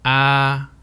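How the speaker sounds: "Ah" held steady on one level pitch; unwavering sung-like "Ah", same level start to end; sustained flat tone. Shown as a demonstration. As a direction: keep the throat steady through a long "Ah". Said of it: "Your throat stays steady".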